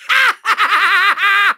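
stuttering gloating laugh; evil cackle. Acted. Villain mocking the Skylander, evil cackle. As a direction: cackle at your enemy.

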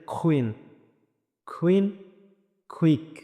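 কুইন, কুইক opened with a puffed k; slow, isolated model words কুইন, কুইক; aspirated Q. Shown as a demonstration. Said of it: "কিউ যদি উচ্চারণ করি তাহলে".

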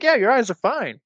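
breezy doctor. Retold or imitated. Doctor telling mom the eyes are fine, breezy and high. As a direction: toss it off lightly.

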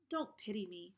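plain reading voice

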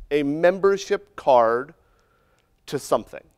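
usual speaking voice

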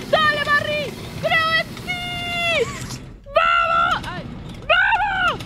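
very high squealing cheer; high, desperate rooting; frantic cheering. Real, not acted. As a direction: egg a teammate on at the top of your range. Cheering Barry on through danger.

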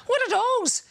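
put-on high-pitched comic Irish accent. Pub attendant puzzled by fallen tees, Irish accent. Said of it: "shall I do the Irish accent".

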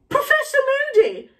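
very high, shocked cry; scandalised exclamation. A narrator's reading. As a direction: call out the name aghast, high-pitched. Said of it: "said a shocked voice".

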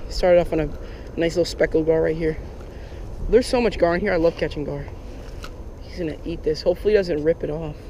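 usual speaking voice

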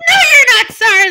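loud, high indignant shout; angry rebuff. Acted. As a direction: shout down the apology. Victim rejects the apology.

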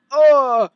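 loud, high startled "oh!"; jump-scare cry; startled yelp. Real, not acted. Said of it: "that really scared me".

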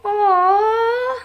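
high, loud tender aww; touched aww. Real, not acted. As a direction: coo at something cute. Cute gift reaction.

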